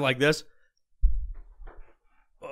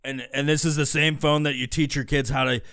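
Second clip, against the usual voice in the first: ordinary talk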